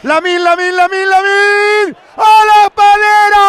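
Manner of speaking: building from calling the name to a loud yell; rising live call. Real, not acted. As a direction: call the shot as it happens. Live call as Lamine shoots.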